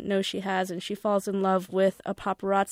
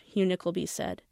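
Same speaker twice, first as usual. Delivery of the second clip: usual speaking voice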